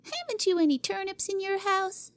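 put-on high, sniffy girl's question; contemptuous. Cora testing Jimmy's family pantry: contemptuous high child voice. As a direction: look down on him. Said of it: "she inquired contemptuously".

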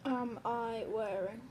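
slow, broken up word by word; typing aloud. Real, not acted. YouTuber texting a test question to the stranger, slow and halting. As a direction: speak at the speed of typing. Saying words while typing a text.

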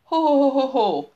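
a big hearty Mikulás ho-ho-ho; Santa's ho ho ho. A narrator's reading. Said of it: "Mutató ujját felemelve így szólt". (harsány télapós ho-ho-ho)

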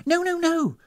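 very high, hasty protest; flustered denial. A narrator's reading. Hostess trying to explain to guest, flustered. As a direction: backpedal in a fluster. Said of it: "tried to explain".

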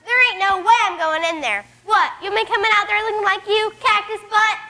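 excited hyena shouts. Acted.

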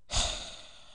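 long, breathy exhale; disappointed sigh. Real, not acted. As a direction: sigh out the letdown. Deceived over the Torag legs.

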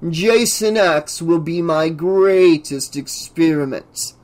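slow, self-glorifying professor; grandiose scientist. Acted. Professor boasts to his assistant, grandiose. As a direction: proclaim it with drawn-out pomp.

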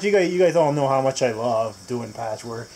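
low, dryly ironic aside; sarcastic. Real, not acted. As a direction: feign love for a hated chore, deadpan and low. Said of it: "A little bit of sarcasm".

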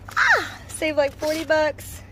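high squeals of delight; excited. Real, not acted. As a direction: cheer about the savings. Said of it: "Very excited about this".

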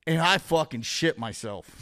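usual speaking voice

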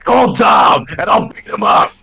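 shouted, drawn-out ad sign-off; hammed-up commercial shout; mock commercial pitch. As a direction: yell it like a tacky local ad. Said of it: "makes up a stupid commercial".

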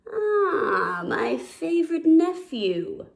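slow, drawn-out grumble; grumbling lion uncle. A narrator's reading. Lion uncle greeting his favorite nephew, grumbling. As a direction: grudgingly greet him, stretching the words. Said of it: "Scar grumbled".

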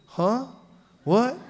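surprised huh. Retold or imitated.

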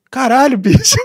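high excited quote; quoted exclamation. Retold or imitated. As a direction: imitate him exclaiming. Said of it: "E dele falou".

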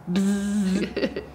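buzzing buzzer noise; wrong answer buzzer. Pretend. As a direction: buzz like a game show buzzer. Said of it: "make a buzzer sound like this".

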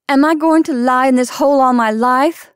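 loud, high, indignant question; demanding. A narrator's reading. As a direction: demand an answer from the doctor. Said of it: "she demanded".

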